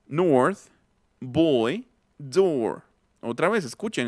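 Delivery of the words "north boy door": put-on "North, boy, door" with O placed further back; long round back O in "North, boy, door", word by word. Shown as a demonstration. Teacher repeats the right sound, back O in "North, boy, door". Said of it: "una O que estoy colocando más atrás".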